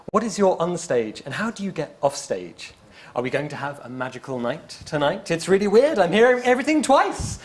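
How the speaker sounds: usual speaking voice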